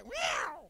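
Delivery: high squeaky mock meow; a loud cartoon cat cry. Imitating the cat squashed.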